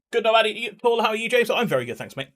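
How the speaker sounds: usual speaking voice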